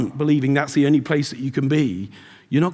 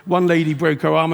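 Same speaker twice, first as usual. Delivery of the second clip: usual speaking voice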